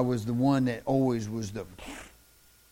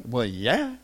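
ordinary talk, nothing marked

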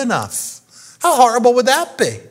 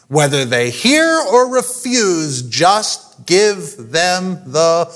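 slow emphatic repetition; 4 semitones lower, slower than usual. Shown as a demonstration. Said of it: "Twice now, God says".

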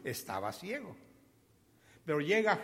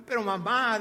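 high, loud plea to mom; acted-out boyish protest; excited little boy; 6 semitones higher, 5 dB louder than usual. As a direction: insist eagerly, like a pestering child. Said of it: "pero excitado, excitado, excitado".

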